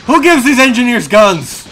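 loud, high-pitched outrage; indignant yelling. Real, not acted. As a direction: yell it in exasperated disbelief. Yells at game's armed engineers.

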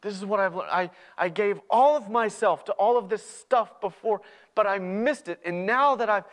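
high, loud, urgent persuasion; impassioned pleading. Acted. Younger brother urging brother to trust him, impassioned. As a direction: implore him to believe you.

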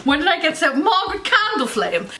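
high, hammed-up airs; affected grand-lady voice. Vlogger mocking their own airs, hammed-up high voice. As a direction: put on lofty airs, pitched high.

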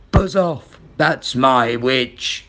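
loud start, then low and slow; slow, threatening beast voice; terrible beast voice. A narrator's reading. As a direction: warn off a rival as a monster. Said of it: "its terrible voice".

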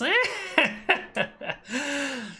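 high, sinister cackle from the dark; cackling laugh. Acted. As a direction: cackle like a lurking villain. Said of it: "a cackling voice from the darkness".

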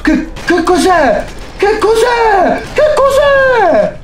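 rising, shrill repeated what is that; terrified scream. Real, not acted. Sees the monster, screams.

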